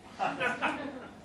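a short amused laugh breaking through the reading. Real, not acted. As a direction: chuckle at the absurd line. Narrator laughs at absurd detail.

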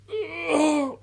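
high straining groan; strained grunt of effort; effortful groan. A narrator's reading. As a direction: groan while hauling yourself up. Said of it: "hauled himself to his feet".

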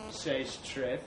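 ordinary delivery

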